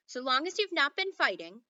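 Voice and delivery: a sharp, knowing warning; sharp teacher. A narrator's reading. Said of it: "giving them a sharp look".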